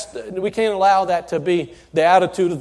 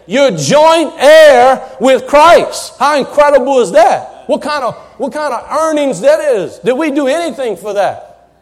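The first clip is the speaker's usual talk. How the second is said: full-force preaching; 3 semitones higher, 6 dB louder than usual. Real, not acted. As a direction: lift the room with it.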